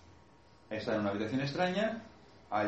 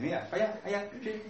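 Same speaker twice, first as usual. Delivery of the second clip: put-on child's voice; 11 semitones higher than usual. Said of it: "le va y dice".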